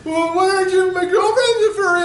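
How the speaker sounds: high, loud indignant whine; mock upset high whine; offended furry guy. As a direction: whine it high like a defensive fan. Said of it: "he's like".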